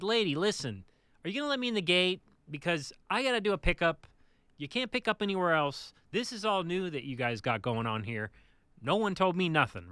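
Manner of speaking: annoyed, forceful complaint, retold; retold annoyance. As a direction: re-enact losing patience with an official. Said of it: "I kinda got annoyed".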